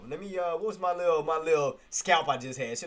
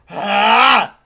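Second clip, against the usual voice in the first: frustrated shout; 7 semitones higher, 18 dB louder than usual. Real, not acted. Frustrated outburst at trade.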